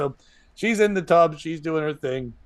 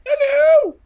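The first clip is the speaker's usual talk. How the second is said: high shout in falsetto; high yelled hello; 7 dB louder than usual. Acted. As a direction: call it back shrill and loud. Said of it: "I'll do it back".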